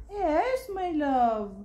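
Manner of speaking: high lilting answer that glides down. Real, not acted. Mother answering her baby. (malambing na sagot sa sanggol)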